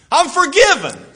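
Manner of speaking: high, slow joyful cry; joyful exclaim. Retold or imitated. The forgiven man rejoicing, a high joyful cry.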